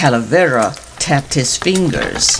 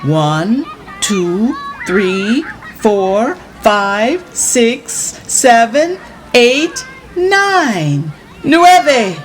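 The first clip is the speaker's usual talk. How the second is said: building count; 7 semitones higher, much slower than usual. Acted. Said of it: "took a deep breath".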